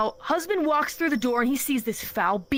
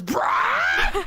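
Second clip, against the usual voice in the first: crunch bird roar; 5 semitones higher than usual. Retold or imitated. Teller voicing the crunch bird, mock roar. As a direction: bark it out like a wild beast bird. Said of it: "the crunch bird goes".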